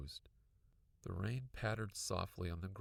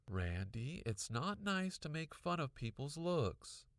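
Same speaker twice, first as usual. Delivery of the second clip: crossly; 8 semitones higher than usual. A narrator's reading. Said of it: "Mom said crossly".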